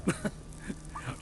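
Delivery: manic chuckle. Acted. Prisoner amused by his own words, soft manic chuckle. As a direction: chuckle softly at your own remark.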